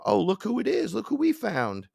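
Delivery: sing-song gloating captor; taunting alien. Retold or imitated. Aliens taunting him on table.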